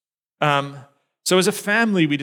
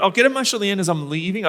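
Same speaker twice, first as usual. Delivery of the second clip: fast, higher self-reassurance, rushed out; quick and a little louder, sure he has it handled; inner planning; 6 semitones higher, 4 dB louder, much faster than usual. Retold or imitated. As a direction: rattle off the plan to stay in control. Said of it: "I thought".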